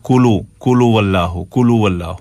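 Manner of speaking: "कुलु वल्लाहु" run together with plain k; everyday "कुलु वल्लाहु", unchanted; wrong common reading. Shown as a demonstration. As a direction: say "कुलु" as koo-loo, dropping the h of hu. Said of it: "अब ये गलत है".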